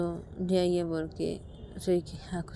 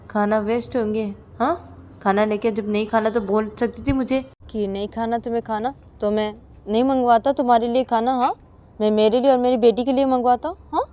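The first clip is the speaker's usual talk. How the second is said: loud, higher-pitched angry lecture; scolding husband; 5 semitones higher, 12 dB louder than usual. Acted. Husband scolding wife over wasted food, loud and angry. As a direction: tell someone off with sharp questioning tags.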